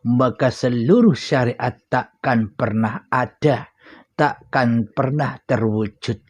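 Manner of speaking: ordinary talk, nothing marked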